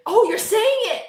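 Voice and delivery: high-pitched mock exclamation; excited guesser. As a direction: call it out like an excited game player. Acts out excited guesser in game.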